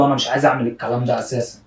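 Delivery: usual speaking voice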